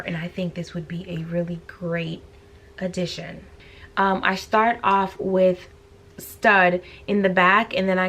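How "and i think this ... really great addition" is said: whispering. Real, not acted. Aside while doing makeup.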